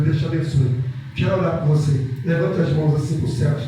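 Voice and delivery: loud call-and-response preaching; loud fervent prayer leader over music. Acted. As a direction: lead the congregation's declarations at full force. Leads congregation in loud prayer.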